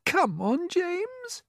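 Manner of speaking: high, gently encouraging ladybird; coaxing. A narrator's reading. Ladybird urging James to go on, coaxing. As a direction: coax him along in a high voice. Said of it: "coaxing him".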